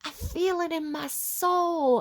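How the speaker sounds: high, slow imitation of an American blues accent; old blues accent. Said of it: "that kind of old blues accent".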